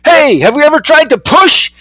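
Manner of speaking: high call out to everyone; yelled question. A narrator's reading. A fly calls out the same old idea, high. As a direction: shout the idea as if it's new. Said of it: "somebody yelled".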